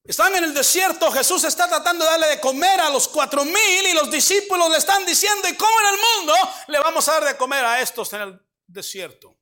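fervent sermon delivery; preaching. Acted. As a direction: lift the voice to preach the scene. Preacher raises voice retelling.

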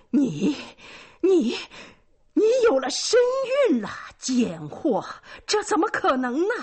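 high, loud stammered outrage; stammering in shock. A narrator's reading. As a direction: stutter out the words in shocked fury. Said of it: "结结巴巴地说". (又惊又怒、结结巴巴)

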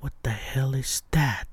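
spooked whisper, breath only; whispered alarm. Retold or imitated. Driver whispering at a moving shadow overhead. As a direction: whisper it as if something might hear you. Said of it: "and then I'm like".

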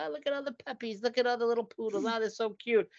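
put-on high, cooing baby talk over cute puppies; gushing over puppies. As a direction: coo at the little poodles, a bit fast. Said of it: "you're like".

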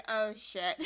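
loud laughing "oh shit"; laughing exclamation. Retold or imitated. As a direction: blurt it out through a laugh. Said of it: "I said".

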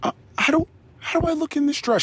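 high put-on woman's voice asking; mock wife. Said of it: "a woman might say".

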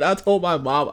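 ordinary delivery

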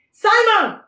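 loud, high shout of a name; calling out. Acted. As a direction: holler for someone to come over. Crucifiers call out to Simon.

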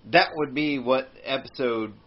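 ordinary delivery

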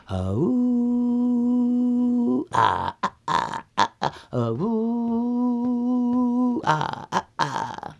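hoot, then grunt like approaching animals; bears' distant calls. Acted. Bears coming home from the woods, hooting calls. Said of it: "And then you hear".

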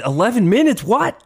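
high, rising shocked question; mock outrage at the length; shocked disbelief. Retelling shocked reaction to clip.

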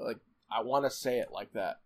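ordinary delivery

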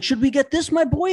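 mock-generous high dad voice; magnanimous dad. Dad offering his boy gold boas, mock magnanimous. Father indulging kid in store.

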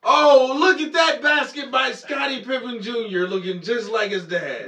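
high, loud excited commentator; announcer call. Retold or imitated. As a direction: shout it like a play-by-play announcer. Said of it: "when's the last time you heard".

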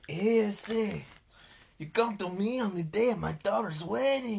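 put-on high, loud Cuban gangster accent; hammed-up Scarface mobster voice. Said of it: "You're doing a Scarface accent on a Godfather movie".

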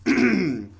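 slow dramatic sigh of a word; dramatic Dang. Pretend. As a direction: play it as a sorry exit line. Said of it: "I will now do a dramatic monologue".

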